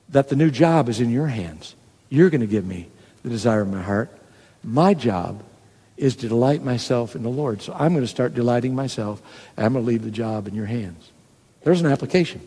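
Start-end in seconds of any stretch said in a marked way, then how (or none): 0.1-11.1 low, soft personal prayer; softly resolved, voice dropped low; quiet surrender. Acted. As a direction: hand it over to God, low and hushed. Models a surrendering prayer to God.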